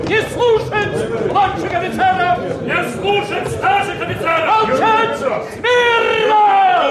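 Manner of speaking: high commanding yell; shouting orders. Acted. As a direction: bellow over the crowd. Shouting down the chaos. (громкие крики команд)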